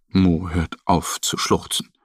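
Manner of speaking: plain reading voice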